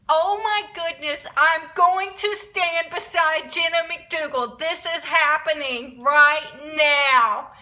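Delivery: high, loud hammed-up excitement; fangirl freak-out. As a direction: build to a drawn-out, overwhelmed finish.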